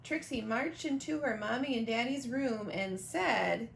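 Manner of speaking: ordinary delivery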